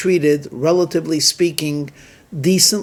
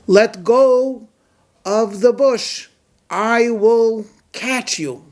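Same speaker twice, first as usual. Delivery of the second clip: slow, raised voice of God; God's voice; 5 semitones higher, slower than usual. Acted. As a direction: speak slowly as God from above. God voice answers the man.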